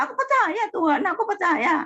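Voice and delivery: a sudden high imploring prayer; imploring cry. Retold or imitated. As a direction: beg God to believe you, voice lifted high. Pleading prayer, voice jumps high.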